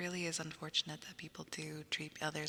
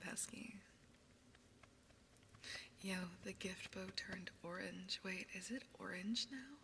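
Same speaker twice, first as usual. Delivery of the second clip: whispering; 7 dB quieter than usual. Acted. ASMR whisper.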